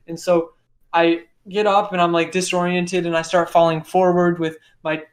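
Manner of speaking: plain reading voice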